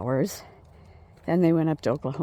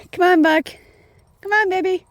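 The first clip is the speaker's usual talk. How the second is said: high, loud coaxing call to a dog; 10 dB louder than usual. Real, not acted. Calling the dog while walking.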